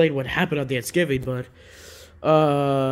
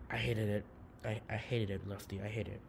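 sulky mutter; 5 semitones lower, 12 dB quieter than usual. Acted. Puppet sulks about bad turkey.

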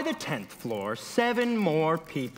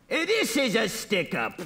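acted stick-up man's voice; crying out bandit; 3 semitones higher than usual. A narrator's reading. Strange man with a gun to the elevator, crying out. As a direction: call it out as a robber. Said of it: "cried the strange man".

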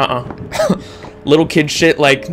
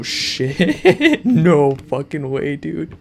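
scared horror game streamer; 2 semitones higher, much slower than usual. Real, not acted. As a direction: jump and laugh it off.

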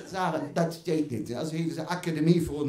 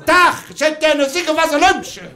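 mock customer; 9 semitones higher, 12 dB louder than usual. Customer asking at shop counter.

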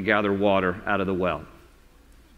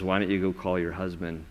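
softer, gentle, searching question; quiet probing; 5 semitones lower, 7 dB quieter than usual. Pretend. Jesus quietly asking the Samaritan woman about her husband. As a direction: lay her heart open quietly. Jesus asks, voice dropping low.

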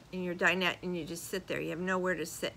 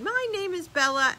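higher sing-song little puppy voice; cute puppy voice; 10 semitones higher, 6 dB louder than usual. Retold or imitated. Vlogger voicing a Pomeranian puppy's greeting, lightly higher. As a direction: say it like a tiny dog.

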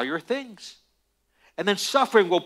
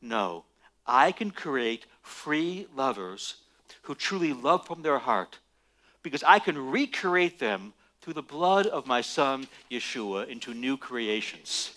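slow, much lower and softer, tender and assured; God's calm reply; 7 semitones lower, 5 dB quieter than usual. Acted. Preacher voicing God answering Satan, slow and tender. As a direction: let God answer gently, with deep calm. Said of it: "And God says".

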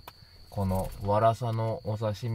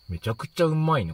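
higher, louder burst of delight; real delighted surprise; 8 semitones higher, 7 dB louder than usual. Real, not acted. Host tasting the flying fish paste, excited delight. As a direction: exclaim it, genuinely bowled over by the taste. First bite tastes surprisingly good.

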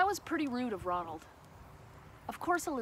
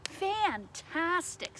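delighted; 5 semitones higher, much slower than usual. A narrator's reading. Princess cheers the dragon on.

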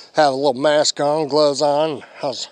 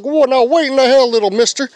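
startled outburst; 9 semitones higher, 5 dB louder than usual. Retold or imitated. Startled to see his old nemesis.

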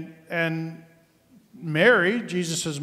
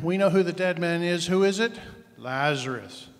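ordinary talk, nothing marked

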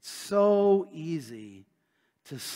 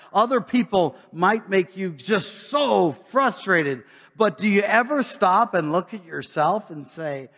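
raised, driving preacher voice, a bit louder; lively preaching; 4 semitones higher, 6 dB louder than usual. Acted. Preacher challenging the congregation, a touch louder and driving. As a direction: press the point home to the flock.

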